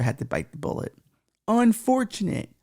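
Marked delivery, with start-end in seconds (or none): 1.5-2.5 drawn-out, higher mock sorrow on "Unfortunate."; hammed-up sorrowful "Unfortunate."; mock lament. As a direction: stretch "Unfortunate." slowly, high, like fake grief. Mock lament over the news.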